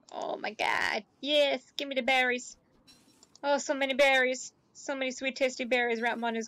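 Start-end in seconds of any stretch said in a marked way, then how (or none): 0.1-1.0 breathy whispered oh my god; awed whisper. Real, not acted. Streamer marvels at berries, awed whisper. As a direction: whisper it like you can't believe your luck.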